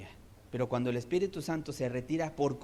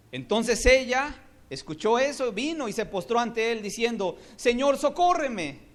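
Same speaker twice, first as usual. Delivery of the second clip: fiery preaching; 8 semitones higher, 7 dB louder than usual. Acted. Preacher voicing the woman's plea.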